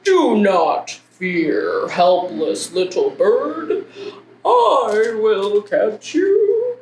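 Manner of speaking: very slow, drawn-out slug character; slow-motion creature trying to sound heroic. Acted. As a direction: stretch every word out like a ponderous slug. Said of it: "And he's saying".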